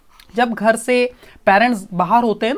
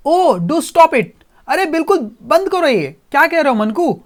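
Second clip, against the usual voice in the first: sharp, louder and higher scolding; boy snapping at his robot to stop, louder and higher; angrily; 5 semitones higher, 4 dB louder than usual. Acted. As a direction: tell the robot off with real irritation. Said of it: "angrily".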